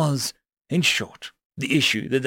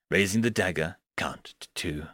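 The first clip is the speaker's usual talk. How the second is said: usual speaking voice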